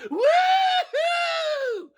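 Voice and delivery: loud, very high "Woohoo!" squeal-shout; woohoo girl. Retold or imitated. The woohoo girls screaming before the show, loud high whoop. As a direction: shriek it like a tipsy party girl. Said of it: "and you're like".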